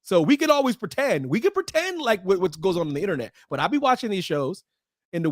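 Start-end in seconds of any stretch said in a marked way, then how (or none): none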